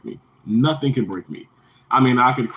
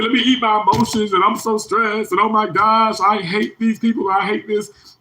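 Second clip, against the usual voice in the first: high-pitched mock griping; mock complainer; 9 semitones higher than usual. Streamer mimicking talk he refuses to use, mock complaining. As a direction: imitate a stressed-out whiner, pitched up high. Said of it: "I don't talk like that".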